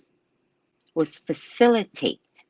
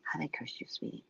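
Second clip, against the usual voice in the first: soft near whisper; 5 semitones lower, 19 dB quieter than usual. Retold or imitated.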